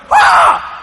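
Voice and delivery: high shrieking scream; child's scream. Retold or imitated. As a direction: let out a startled child's scream. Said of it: "And Jody went".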